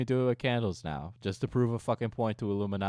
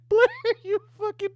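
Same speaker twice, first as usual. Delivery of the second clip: high, giggling accusation, louder on the name; laughing mock outrage; 4 dB louder than usual. Real, not acted. Streamer mock-scolding Blair over the price, laughing high. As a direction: laugh out the insult like a joke.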